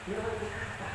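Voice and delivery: soft quote. Retold or imitated. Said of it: "Nabi hanya mengatakan".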